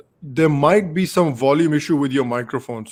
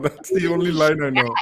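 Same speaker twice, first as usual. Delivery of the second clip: usual speaking voice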